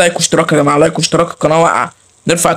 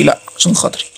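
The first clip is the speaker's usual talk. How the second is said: whispered plea; 4 semitones lower, 4 dB quieter than usual. Real, not acted.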